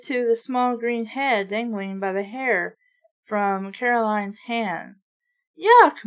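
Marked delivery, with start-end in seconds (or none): 5.6-6.1 high, loud cry of disgust; loud yelp of disgust. A narrator's reading. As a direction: recoil out loud at something gross. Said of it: "Mom cried".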